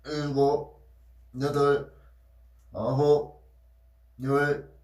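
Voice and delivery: loud strained counting mid push-up. Real, not acted. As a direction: call out each rep, straining. Counting push-ups aloud, straining. (팔굽혀펴기 하며 힘주어 세기)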